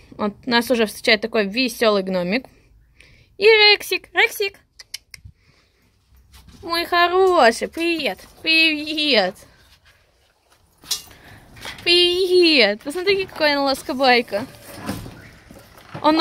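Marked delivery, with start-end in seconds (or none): 3.4-4.6 calling a dog. Real, not acted. 6.6-12.8 talking to a dog. Real, not acted. Greeting the dog warmly.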